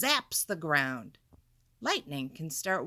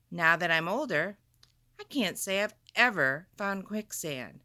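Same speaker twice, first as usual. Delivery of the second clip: ordinary delivery, nothing marked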